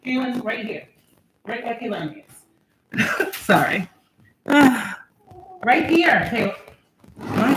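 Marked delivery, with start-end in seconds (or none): 0.0-3.4 soft directing. Real, not acted. Softly directing child nearby.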